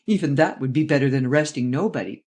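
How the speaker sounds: ordinary delivery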